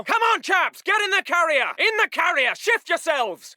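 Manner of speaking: high, insistent command; shouted order. Acted. Officer ordering men onto the vehicle, shouting. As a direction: shout them into moving.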